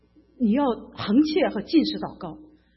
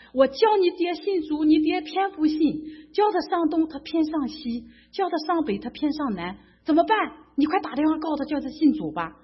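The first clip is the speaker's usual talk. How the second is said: raised-pitch retelling of an exasperated mother; mother's complaint; 6 semitones higher than usual. Retold or imitated. Mother on the phone begging her son to make his father believe, exasperated and higher-pitched.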